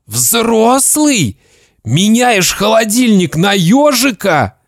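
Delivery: high, strained indignation; outraged father. Acted. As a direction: scold the boy in disbelief. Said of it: "Папа так зашипел".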